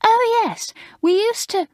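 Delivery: high, prim, gushing schoolgirl voice; prim new girl. Acted. Maureen, alarmed, telling the fifth formers off, high and prim. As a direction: play the prim girl shocked at the idea.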